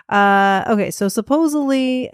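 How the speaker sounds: ordinary talk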